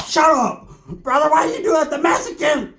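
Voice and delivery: high, put-on yelled protest; mock indignant shout. Dwarfwood walking away complaining, high indignant shout. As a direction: yell it as an aggrieved little guy.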